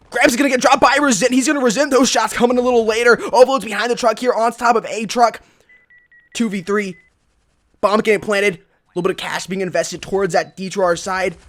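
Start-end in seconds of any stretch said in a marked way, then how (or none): 0.1-8.5 loud, high esports caster call; live play-by-play. Real, not acted. Caster calling a firefight for viewers, excited play-by-play. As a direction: call the action with rising urgency.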